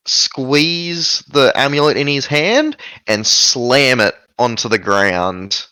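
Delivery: ordinary delivery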